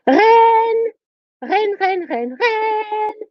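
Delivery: "Reine" called out high and repeated; high playful call. Shown as a demonstration. Teacher doing antlers for children, high playful call. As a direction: squeal "Reine" excitedly, holding the last one. Calling the word with antler action.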